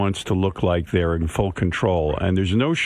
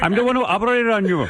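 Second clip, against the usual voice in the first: prank caller voice; 12 semitones higher than usual. Pretend.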